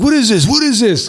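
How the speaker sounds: high, playful mock-suspicious voice; imitating K Slade. K Slade joking as he gets in the car, high playful voice. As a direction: tease him, pitched well up.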